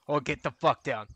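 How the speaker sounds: loud urgent yell; shouting. Real, not acted. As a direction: bark the warning. Sudden enemy contact, yelling warning.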